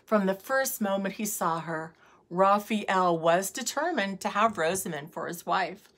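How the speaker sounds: usual speaking voice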